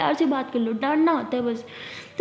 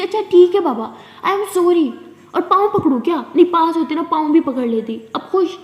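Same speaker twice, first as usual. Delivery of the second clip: loud, high, mocking sorry; snapping a sarcastic sorry at her friend; rude sarcastic apology; 4 semitones higher, 5 dB louder than usual. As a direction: throw the apology back with scorn. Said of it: "इतना rude बात क्यों कर रहे हो".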